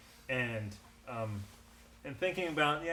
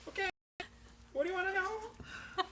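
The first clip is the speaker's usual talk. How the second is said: caught goblin; 6 dB louder, slower than usual. Acted.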